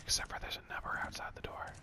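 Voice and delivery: soft, breath-only whisper; mock ASMR whisper. Streamer fakes an ASMR phone call, whispering. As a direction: play at ASMR, whispering into the mic. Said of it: "to be doing A S M R".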